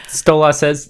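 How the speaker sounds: usual speaking voice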